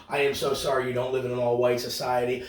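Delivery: ordinary talk, nothing marked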